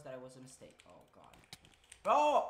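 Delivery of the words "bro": sudden loud yell of "Bro!"; startled call; loud shout. Real, not acted. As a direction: shout it out to the guys. Sudden exclamation to friends.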